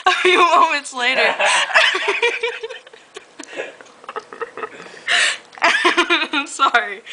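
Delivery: can't hold back the laughter; laughing through words. Real, not acted. Reader cracking up over the kiss line, laughing.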